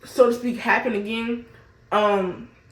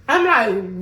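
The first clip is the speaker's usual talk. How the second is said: usual speaking voice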